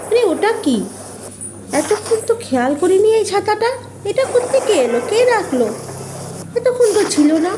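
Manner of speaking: startled by a strange umbrella; puzzled surprise. Acted. Finds mysterious umbrella. (অবাক হয়ে প্রশ্ন)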